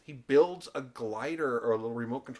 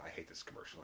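hushed retelling; 7 semitones lower, 17 dB quieter, faster than usual. Retold or imitated. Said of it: "And Dustin says".

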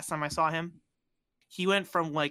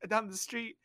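ordinary talk

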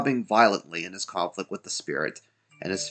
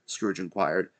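ordinary delivery

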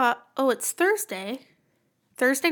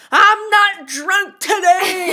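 loud, high, drawn-out mock yell; imitated drunken yelling; 6 semitones higher, 7 dB louder, much slower than usual. Some guy on the train announcing himself, mock yelling. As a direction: bellow it slowly like a rowdy train passenger. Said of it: "yelling on the fucking train".

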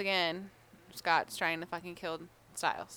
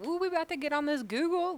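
excited friend; 8 semitones higher, faster than usual. Retold or imitated. Eager friend voiced.

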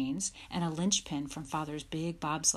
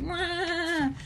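high, loud animal cry; bawling calf; 12 semitones higher, 4 dB louder than usual. A narrator's reading. Star bolts with the sled, bawling. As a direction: bawl like a startled calf.

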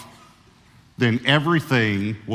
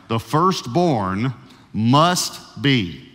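"must" drawn out slowly, slow and deliberate; slow dictation; much slower than usual. Shown as a demonstration. Pastor repeats the point slowly for people writing, slow dictation. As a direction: pause on each word for the note-takers. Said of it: "long enough for you to be able to".

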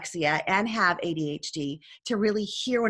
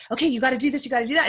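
hurried, louder, higher instructions; speaking fast; 4 semitones higher, 5 dB louder, much faster than usual. Retold or imitated. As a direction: rattle it off before the next call. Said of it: "I'm just speaking fast".

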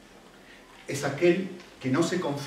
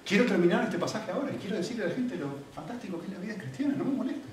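mock irritation; 5 semitones higher, 4 dB quieter than usual. Mocks his own irritated reply.

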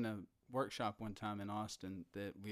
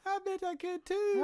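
much higher, thin voice; high voice demo; 4 dB louder than usual. Shown as a demonstration. Guest showing her voice coach's advice in a higher voice. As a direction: push your voice way up. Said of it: "go higher than that".

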